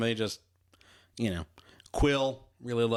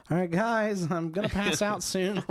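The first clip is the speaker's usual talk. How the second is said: trapped man; 8 semitones higher than usual. Retold or imitated. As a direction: call out weakly from inside the box.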